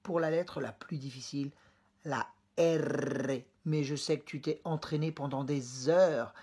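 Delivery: ordinary talk, nothing marked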